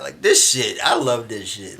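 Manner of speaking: normal talking voice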